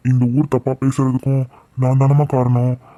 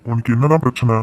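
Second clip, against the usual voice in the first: ordinary delivery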